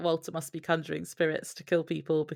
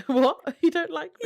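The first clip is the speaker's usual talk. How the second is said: high, squeaky disbelief; mock hurt surprise; 12 semitones higher, 7 dB louder than usual. [name] in the background, stung by Dean's words, mock shocked squeak. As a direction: act offended and baffled at once.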